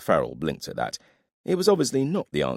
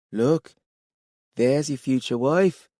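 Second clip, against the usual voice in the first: teasing voice; 10 semitones higher, 4 dB louder, slower than usual. Acted.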